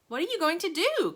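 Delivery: put-on high alarmed boy's question; anxious cry. A narrator's reading. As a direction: ask in sudden alarm. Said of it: "cried Charlie".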